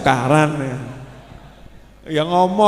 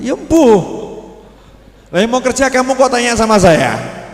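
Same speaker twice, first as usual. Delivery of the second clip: exasperated reply; 8 semitones higher, 7 dB louder than usual. Acted. Wife answering husband's job question.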